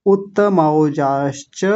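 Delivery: उत्तमौजाश्च slowly with double t and मौ; teacher demo for students; slow careful recitation. Shown as a demonstration. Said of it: "इसको ध्यान रखेंगे".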